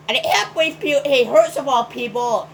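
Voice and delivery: usual speaking voice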